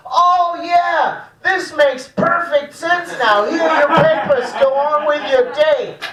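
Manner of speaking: high-pitched, hammed-up border agent; mock sudden acceptance. Border agent waving the comedian through, exaggerated mock acceptance.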